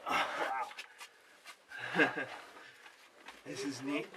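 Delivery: ordinary talk, nothing marked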